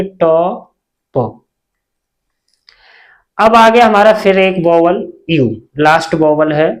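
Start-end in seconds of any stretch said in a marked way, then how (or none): 0.0-1.5 टॉप very slowly, split in two; sounded out. Shown as a demonstration. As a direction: stretch टॉ, long pause, then प. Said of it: "ट से पढ़ेंगे". (टॉ... प, धीरे-धीरे तोड़कर)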